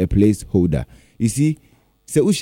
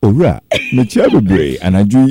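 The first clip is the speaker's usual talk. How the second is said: sexy voice; 5 semitones lower than usual. Acted. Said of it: "Sexy voice".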